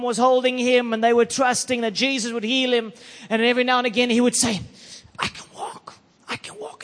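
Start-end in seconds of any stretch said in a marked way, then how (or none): 5.2-6.8 faint whisper, imitating a little boy; whispered child's words. Preacher voicing a four-year-old who couldn't walk, whispered. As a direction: whisper it as the struggling boy would.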